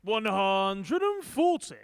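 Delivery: long drawn-out score call; darts caller. Acted. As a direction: stretch the call out, booming.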